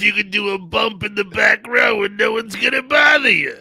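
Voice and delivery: loud, fast joking boast; loud joking shout. Real, not acted. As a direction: shout the tip with glee. Mock loud advice.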